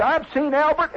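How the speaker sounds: high-pitched, insistent witness; worked-up testimony. Acted. Club member vouches for Albert's deadly tail, worked up.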